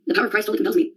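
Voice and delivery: ordinary talk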